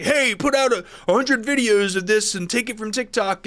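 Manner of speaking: mock a pushy online guru. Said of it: "these gurus are making that say".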